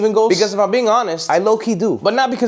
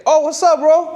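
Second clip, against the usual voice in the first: much higher, friendly call down; pitched-up surprised greeting; 10 semitones higher, slower than usual. Retold or imitated. Tony calls down from the balcony, friendly and high. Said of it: "I say".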